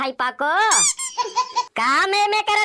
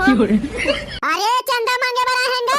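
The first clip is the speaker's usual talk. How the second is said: drawn-out yell, higher and slower; loud shout; 5 semitones higher, slower than usual. Acted. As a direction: bellow the words slowly to the whole house. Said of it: "फिर बिक्कट जोर से चिल्लाते".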